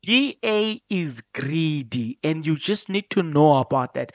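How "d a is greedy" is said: each word of "D A is greedy" laid down slowly and firmly; slow emphasis. Real, not acted. To listeners, hammering home the point slowly. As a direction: spell it out slowly so it sinks in. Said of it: "how best can I emphasize this".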